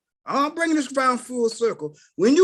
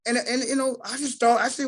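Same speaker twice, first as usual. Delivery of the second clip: ordinary delivery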